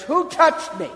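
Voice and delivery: loud, high demand to the crowd; Jesus calling out. Acted. Jesus turning to the packed crowd, loud high call. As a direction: call out over the crowd, searching.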